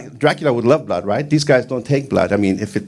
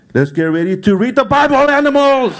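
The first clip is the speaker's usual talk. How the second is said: dad's order sung out higher and louder like a fight call; announcer-style dad; 11 semitones higher, 4 dB louder than usual. Retold or imitated. Comedian mocks his dad as a ring announcer calling bible time. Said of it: "My dad was almost like".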